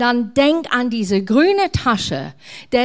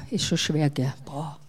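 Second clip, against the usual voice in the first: mock strain under weight; 7 semitones lower, 9 dB quieter than usual. Acted strain carrying the bag.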